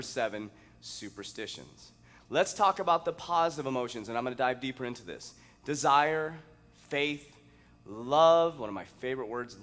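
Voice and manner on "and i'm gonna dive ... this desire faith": usual speaking voice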